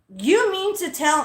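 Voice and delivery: a public callout; said out loud. Retold or imitated. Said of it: "I said it out loud".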